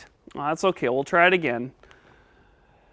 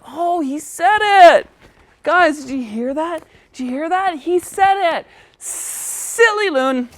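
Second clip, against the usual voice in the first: high, thrilled cheering; excited puppet voice; 9 semitones higher than usual. Acted. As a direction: squeal with delight. Puppet celebrates child's success.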